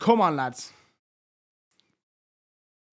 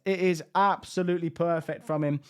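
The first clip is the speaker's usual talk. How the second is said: usual speaking voice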